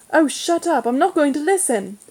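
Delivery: exasperated sisterly protest; upset protest. A narrator's reading. Upset sister cuts off teasing brother.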